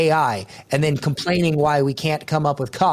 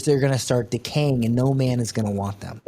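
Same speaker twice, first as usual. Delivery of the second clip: usual speaking voice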